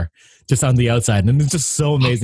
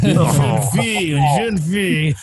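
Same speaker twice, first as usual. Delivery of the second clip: slow, higher, hammed-up French; bad French accent; 5 semitones higher, slower than usual. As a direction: drag out the French words slowly. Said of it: "really bad French accent".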